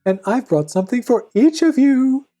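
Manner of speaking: high, sing-song mother imitation; wolf coaxing in a high pretend-mother voice; falsely soft wolf. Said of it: "speak so softly".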